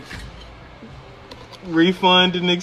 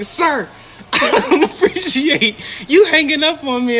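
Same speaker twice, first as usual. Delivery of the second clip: high, outraged customer impression; mock indignant caller; 9 semitones higher than usual. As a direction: mimic her offence through your own laughter. Mimicking indignant caller on third call.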